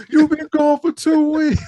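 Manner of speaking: high, loud scolding through a laugh; mock mama, laughing. Retelling the mother scene, laughing mock-scold. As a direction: nag high and loud, cracking up. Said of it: "It's like".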